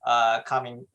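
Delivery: ordinary delivery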